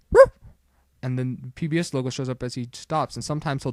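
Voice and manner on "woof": short mimicked woof; imitated dog bark. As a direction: give one quick bark like a dog. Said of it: "this dog comes through and goes".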